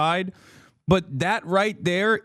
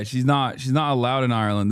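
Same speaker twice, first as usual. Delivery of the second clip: ordinary talk, nothing marked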